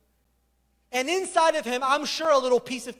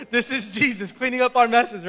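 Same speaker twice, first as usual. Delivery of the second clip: usual speaking voice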